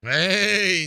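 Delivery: usual speaking voice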